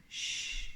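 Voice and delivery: soft drawn-out "shh"; shushing. Acted. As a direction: hush someone quietly. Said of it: "two chameleons there saying".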